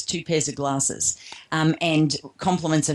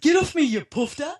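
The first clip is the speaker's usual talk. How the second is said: lightly voiced dad, pitched up; dad's rebuff; 11 semitones higher than usual. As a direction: imitate a dad brushing off a hug, higher pitch. Son retells dad rejecting a hug.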